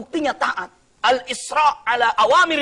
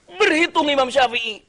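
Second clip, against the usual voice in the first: normal talking voice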